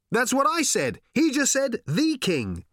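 high, loud protest; shouted reply. A narrator's reading. Arthur answering Ford mid-gallop, high shout. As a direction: shout it back over the hooves.

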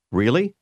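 "Really" with a rise at the end; question intonation. Shown as a demonstration. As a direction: lift the end of "Really" to ask it. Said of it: "the rising tone added to the end".

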